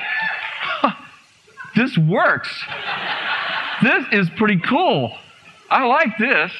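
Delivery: high, pleased, laughing realization; delighted discovery. Retold or imitated. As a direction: marvel with a chuckle at what works. Delighted that the spirit left.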